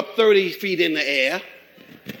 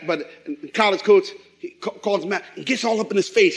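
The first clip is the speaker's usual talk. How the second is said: usual speaking voice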